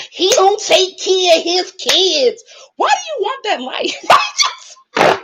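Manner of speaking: slow, high, mocking imitation. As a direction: slowly parody the way they talk, up high. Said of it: "we don't all talk like that".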